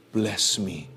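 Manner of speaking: leise, demütige Bitte; demütig. Acted. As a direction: bitte ganz klein und demütig. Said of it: "dengan rendah hati dia berkata".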